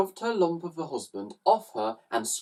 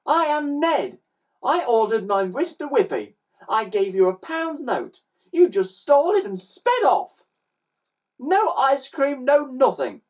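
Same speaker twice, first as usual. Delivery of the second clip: put-on high, aggrieved child's voice; indignant boy; 12 semitones higher, 4 dB louder than usual. A narrator's reading. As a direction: lay out the complaint, pitched high and insistent. Boy lists his grievance.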